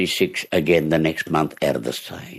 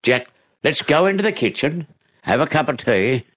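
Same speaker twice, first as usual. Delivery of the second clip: kindly; 8 semitones higher than usual. Retold or imitated. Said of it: "So she".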